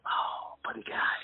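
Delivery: breathy awestruck whisper; whispered awe. Retold or imitated. Telling the host about meeting [name], an awed whisper. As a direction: whisper his name in awe. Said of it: "And I thought".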